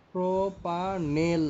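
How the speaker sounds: "প্রোপানেল" drawn out syllable by syllable with a clear e in the last syllable; slow careful pronunciation. Shown as a demonstration. Teacher showing students how to say the aldehyde name, very slow. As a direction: spell out "প্রোপানেল" slowly for the class. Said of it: "এল উচ্চারণ হবে".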